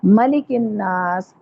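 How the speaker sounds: मलिकिन नास slowly, short 'ma', long final naas; short first vowel. Shown as a demonstration. Teacher models slow recitation, short 'ma'. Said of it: "यहाँ देखें मलिकिन नास है".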